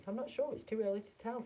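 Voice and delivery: high, light uncertain reply; a hint of a high fussy Wonka. A narrator's reading.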